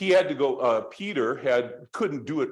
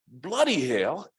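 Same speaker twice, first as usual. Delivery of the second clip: higher-pitched mock Australian accent; indignant Aussie complaint, voice pitched up; best Australian accent; 6 semitones higher than usual. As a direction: put on an Aussie accent, aggrieved. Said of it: "trying to do my best Australian accent".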